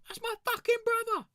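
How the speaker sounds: very high, loud squealed yell; squealed mock yell. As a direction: shriek it in mock panic. Retold panicked reply.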